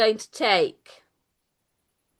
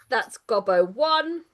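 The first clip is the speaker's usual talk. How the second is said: usual speaking voice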